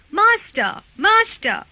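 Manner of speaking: high, loud cry for the master. A narrator's reading. Harp calls to the sleeping giant as Jack runs off, crying out. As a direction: call out in alarm, high and loud. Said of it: "the harp cried out".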